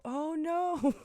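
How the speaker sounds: high, loud startled gasp of oh no; mock alarm at a child falling. Seeing the kid tumble off the porch, a high startled cry.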